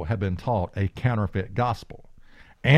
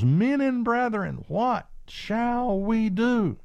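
pleading crowd; 11 semitones higher, slower than usual. Acted. Crowd's plea in Acts reading.

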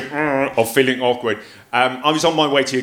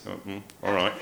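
ordinary delivery

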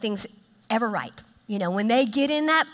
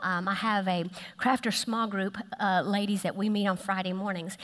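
ordinary talk

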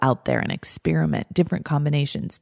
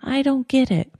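higher, whining that it's all too confusing; mock-whiny confused complaint, pitched up; reluctant whiny voice; 7 semitones higher than usual. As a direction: play the reluctant student who doesn't want to do it. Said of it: "That's my voice, by the way, when I don't really want to do something".

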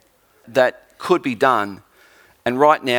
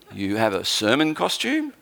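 lower, drawn-up questioning rise; incredulous question; 3 semitones lower, 4 dB quieter than usual. Retold or imitated. Host questions guest preacher about his costume, mildly incredulous. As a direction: query it in mild disbelief.

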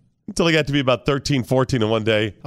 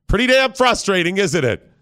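higher, more forceful prodding; insistent goading; 5 semitones higher than usual. Acted. Dad goading son about the toy, insistent needling. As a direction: push harder to get a rise out of him.

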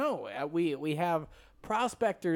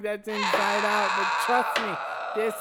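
hammed-up cry while killing it; mock anguish. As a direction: wail a fake sorry to the dog. Killing the dog, crying out.

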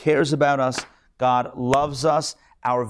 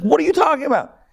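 baffled shriek; 4 dB louder than usual. Retold or imitated. Wife baffled in retold joke.

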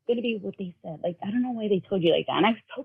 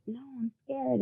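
small, higher, softer frightened imitation; retold scared child; 4 semitones higher, 8 dB quieter than usual. Son telling mom of his fear, scared. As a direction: mimic a child's timid fear. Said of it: "So Jaden's freaking out".

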